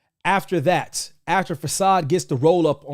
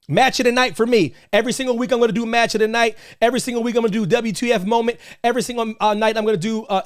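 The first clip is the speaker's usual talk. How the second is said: higher and fast, rattling off segments; hyped pitch; 5 semitones higher, much faster than usual. Real, not acted. Host promising weekly segments to viewers, fast hyped pitch. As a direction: fire off the segment list with hype.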